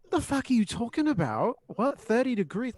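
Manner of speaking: put-on high, loud Australian accent, incredulous question; exaggerated Australian accent. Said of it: "That's my best Australian accent".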